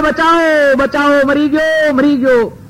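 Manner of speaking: high pitched put-on cries for help; he pretends to be dying, cry out; faked screams for help. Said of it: "જોર જોરથી ચીસો પાડે છે". (ઊંચા સૂરે ખોટી ચીસો)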